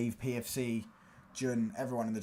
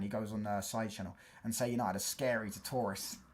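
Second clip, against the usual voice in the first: usual speaking voice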